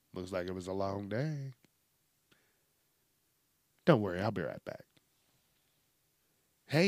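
lightly voiced caring pal, easygoing and soothing; a hint of a kindly dad; consoling robot buddy. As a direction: gently coax someone who seems down. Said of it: "sound like I'm depressed".

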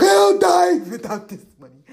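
high, loud melodramatic cry; hammed-up desperate outburst; crazed mock desperation. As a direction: wail it as if life depends on it. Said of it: "but nothing, like, crazy".

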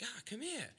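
high-pitched, lightly mimicked English accent; English bartender. English bartender calling the American over, lightly imitated English accent. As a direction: beckon like an English barman. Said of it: "he was, uh, English".

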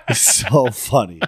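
ordinary talk, nothing marked

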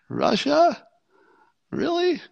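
very high, incredulous squeak after a laugh; mock disbelief. Reacting to Susan Rice blaming Russia, mock incredulity. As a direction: question it in an absurdly high, amused pitch. Mocks Susan Rice's suspicion.

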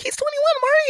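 high-pitched exclamation; girlfriend's mama. Retold or imitated. Mama telling her daughter his age, high-pitched voice. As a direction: imitate a mother exclaiming up high. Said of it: "So she's like".

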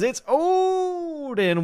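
very high, very slow stretched call of "Odin". Pretend. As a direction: wail the name out like a long call. Reads chat greeting, stretched out.

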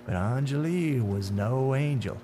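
drawn-out, taunting menace; slow, knowing tormentor with swinging pitch; sinister man in dark suit. Acted.

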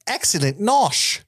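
mock posh aunt. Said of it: "she looks at Petunia and says".

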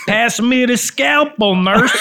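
mock surgeon with a Chicago accent. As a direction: draw it out in a hammed-up Chicago accent. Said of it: "It's the worst accent".

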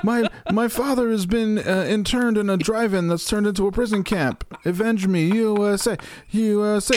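high, hammed-up dying plea; overacted death scene. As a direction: cry out like a dying hero. Red Dawn mock plea.

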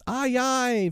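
very high, drawn-out call back; high boyish chorus reply; answering boys. A narrator's reading. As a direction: holler back as eager lads from afar. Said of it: "from various parts of the lagoon".

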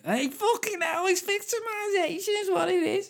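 mock Scouse outrage at a very high pitch; bad Scouse accent. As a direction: double down on a squeaky, hammy Liverpool accent. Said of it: "my bad accent, but I'm, I'm just doubling down".

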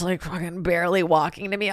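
ordinary talk

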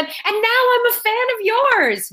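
a hint of her sweet reply, high and bright; sweet high imitation. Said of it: "I did not do that Australian accent".

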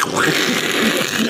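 imitated long spraying spit; voiceless gush, as if spewing a mouthful; spitting noise. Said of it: "like, spit this".

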